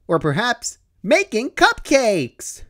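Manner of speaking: high, drawn-out happy Pinkie announcement. Acted. Pinkie reveals to Dash they'll bake cupcakes, high and happy. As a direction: announce it slowly like the best news. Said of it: "Pinkie happily announced".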